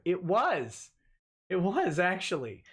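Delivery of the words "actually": ordinary talk, nothing marked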